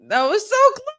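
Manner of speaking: high, loud wail of a near miss; anguished shout. Real, not acted. Fan after a near pinfall, high loud anguished shout. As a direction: cry out in frustration.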